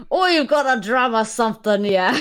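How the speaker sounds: playful, hammed-up Cockney; Cockney accent. Reader repeating the line after being teased, Cockney accent. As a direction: lean into a mock Cockney. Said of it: "you were going Cockney a bit".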